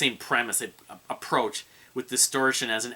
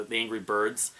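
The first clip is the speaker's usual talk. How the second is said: ordinary delivery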